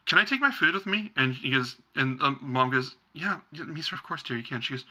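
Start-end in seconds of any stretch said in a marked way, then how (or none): 0.1-1.1 put-on high, eager kid's question; little girl voice. Young daughter asking her mother to take her food outside. 3.1-4.6 soft reassuring mother. Acted. Mother reassures her daughter.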